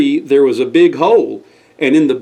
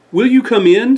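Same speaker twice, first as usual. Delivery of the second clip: higher, hopeful invitation; lightly voiced Squire; 8 semitones higher than usual. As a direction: open the door kindly.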